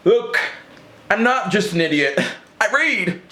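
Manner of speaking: high bragging Instagram reader; show-off boast. Retold or imitated. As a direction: brag like posting proof.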